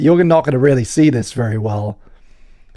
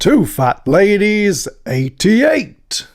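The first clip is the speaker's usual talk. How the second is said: bingo caller; 7 semitones higher, much slower than usual. Pretend. Calling bingo numbers.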